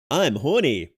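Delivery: a hammed-up catchphrase delivered for the clip; mock catchphrase, played for laughs; catchphrase on request. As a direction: say the line like a signature bit for the fans. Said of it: "Have a catchphrase, please".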